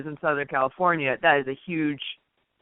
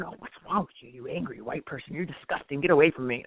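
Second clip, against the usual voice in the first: much softer, lower, sneering mock scold; mock disgust; 5 semitones lower, 6 dB quieter than usual. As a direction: mimic a prissy critic recoiling. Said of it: "and all of a sudden".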